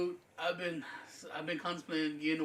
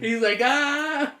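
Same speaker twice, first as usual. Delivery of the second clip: startled yelp; 9 semitones higher, 9 dB louder, much slower than usual. Retold or imitated. Brother startled by dog jumping.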